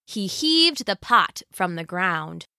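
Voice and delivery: ordinary delivery, nothing marked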